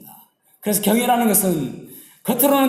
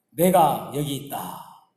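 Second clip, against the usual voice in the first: slow, lower voice answering the cry; voice from above; 5 semitones lower, much slower than usual. Acted. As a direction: answer calmly as a mysterious voice. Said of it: "목소리가 들립니다". (느리고 낮게 응답하는 목소리)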